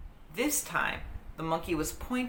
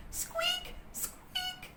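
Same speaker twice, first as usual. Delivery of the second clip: high, tiny squeaks; squeaking monkey; 7 dB quieter than usual. A narrator's reading. Peanut the mouse squeaks at the monkey. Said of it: "She seemed to be yelling".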